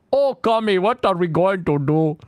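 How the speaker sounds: high-pitched, terrible mock Indian accent; Mister Popo. Shown as a demonstration. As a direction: wail it in a hammed-up Indian accent. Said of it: "He has the worst Indian accent".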